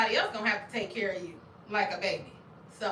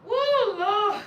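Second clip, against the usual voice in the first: high, loud whoops through laughter; crying laughing; 9 semitones higher, 7 dB louder than usual. Real, not acted. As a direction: wheeze out a laughing Lord. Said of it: "I'm up here crying laughing".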